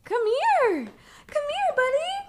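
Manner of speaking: high-pitched coaxing to a pet. Real, not acted. Coaxing the dog.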